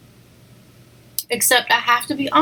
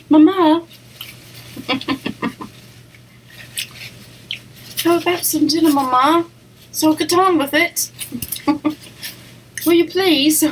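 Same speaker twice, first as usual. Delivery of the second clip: a light, higher mock British accent with giggles; daughter's British accent; 7 semitones higher than usual. Mom imitating her daughter's pretend British accent, giggling. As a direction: playfully imitate a little girl's British accent, breaking into laughs. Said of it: "She likes to pretend she has a British accent".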